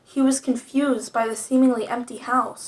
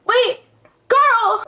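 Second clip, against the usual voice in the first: high-pitched cry to stop someone; raised, pleading shout; urgent plea; 10 semitones higher, 4 dB louder than usual. A narrator's reading. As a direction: call out desperately to halt them. Character calls after hurt partner.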